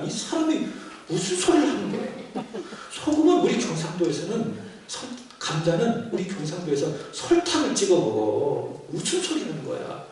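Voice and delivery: high, loud hammed-up retort with laughter; mock indignant husband. Husband snapping back at wife before the pastor, hammed-up indignation. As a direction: ham up the offended husband.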